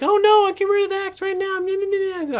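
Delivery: very high, squeaky mock panic; hammed-up falsetto of the scared caller; panicked woman. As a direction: squeal it like a frightened lady on the phone. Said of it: "And the woman's like".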